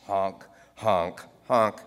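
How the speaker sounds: goose honking. Retold or imitated. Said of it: "make goose-like honking noises".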